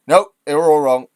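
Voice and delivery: loud, emphatic mock denial; mock dismissal. Streamer mimics prime minister overruling ministers, loud mock dismissal. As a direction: wave it all away at volume.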